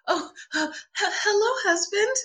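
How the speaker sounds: high, stammering, nervous greeting; flustered. Retold or imitated. Starstruck actress greets her screen husband, high and flustered. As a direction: trip over the words like you're starstruck. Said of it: "I was so flustered".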